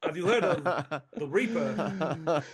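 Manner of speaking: high, halting Walken delivery; Christopher Walken impression. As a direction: play it like Walken undercover. Walken voice as Detective Larson.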